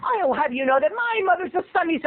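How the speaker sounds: high, indignant little cartoon voice; high, self-righteous kid retort; Scrappy. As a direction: protest like a puffed-up cartoon pup. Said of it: "I sound like Scrappy".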